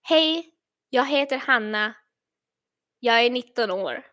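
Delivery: introduction in native Swedish, unhurried; Swedish sample sentence. Shown as a demonstration. Streamer comparing accents for viewers, Swedish sample sentence. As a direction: switch into Swedish, measured for comparison. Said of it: "sentence in Swedish".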